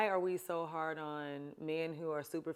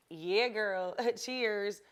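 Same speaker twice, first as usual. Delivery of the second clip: put-on girly man; 5 semitones higher, 5 dB louder, much slower than usual. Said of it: "I'm not saying be like".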